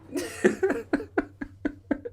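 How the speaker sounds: real high airy laughter; laughing at his own story; breathy laugh. Real, not acted. Laugh alone.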